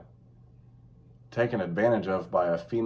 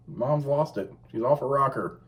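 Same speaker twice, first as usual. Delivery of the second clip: ordinary delivery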